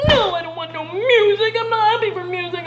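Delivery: high, loud, rushed refusal; king refusing music in distress; upset king. Acted. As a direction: push the music away in grief.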